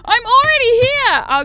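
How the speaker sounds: high, loud exasperated squeal; squeaky shout. Real, not acted. Gamer yelling back at the game's tip, high squeal. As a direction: yell it in a squeaky voice. Repeat of retort.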